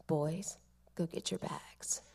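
low, soft, laughing giving in; soft laughing aside. Retold or imitated. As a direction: say it hushed through a laugh. Grandmother giving in, told fondly.

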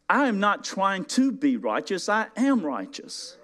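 "am" in "I am righteous" drawn out slow and heavy; stress on am. Acted. Preacher contrasting trying with being, stressed "am". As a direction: lean hard on "am". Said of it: "contrast stress on am".

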